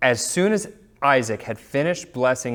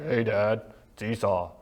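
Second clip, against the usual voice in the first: deeper put-on son's voice; Esau impression; 4 semitones lower, 5 dB quieter than usual. Younger son posing as his brother to blind dad, deep put-on voice. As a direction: drop the voice low to fool dad. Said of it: "had a deeper voice".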